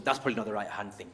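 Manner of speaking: normal talking voice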